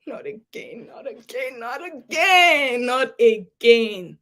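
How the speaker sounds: despairing. Retold or imitated. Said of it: "I felt like".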